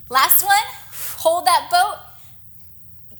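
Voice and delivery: rally the class through the last hold; encouraging push. Acted. Instructor pushing final hold.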